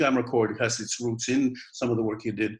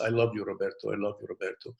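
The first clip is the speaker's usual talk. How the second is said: soft, lower, growing feebler on the repeat; fading voice; 3 semitones lower, 6 dB quieter, faster than usual. Acted. Girlfriend's remembered voice haunting Roberto, fading feebler. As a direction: let the repeated words fade away. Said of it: "it's getting feebler and feebler".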